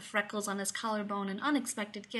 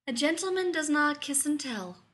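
lofty; 6 semitones higher than usual. A narrator's reading. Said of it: "Rodney said loftily".

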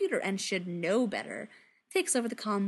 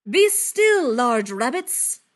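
loud, slow booming command; 2 semitones higher, 9 dB louder, much slower than usual. Acted. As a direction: boom it like a god. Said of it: "booms Thor".

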